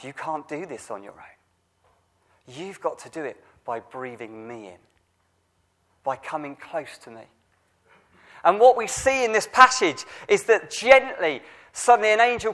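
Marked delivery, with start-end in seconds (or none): 0.0-7.2 gentle, hushed divine voice. Acted. God's gentle words to Elijah.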